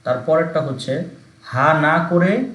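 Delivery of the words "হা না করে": হা না করে slowed right down and stressed; slow stressed phrase. Shown as a demonstration. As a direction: drag out হা না করে slowly. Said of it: "মানে হা করা যাবে না".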